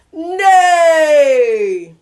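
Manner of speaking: loud horse whinny; bellowed neigh. Acted. Duck bellowing like a horse in the barn. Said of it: "bellowed out a".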